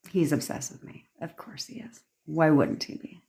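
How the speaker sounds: soft, whispered certainty; quiet self-assured calm; hushed confident. Retold or imitated. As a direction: whisper it like a sure secret. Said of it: "Versus if you're to be like".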